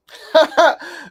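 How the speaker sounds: high, loud laugh; burst of laughter. Real, not acted. Man pleased to follow his grandfather, high loud laugh. Laughs before proudly repeating himself.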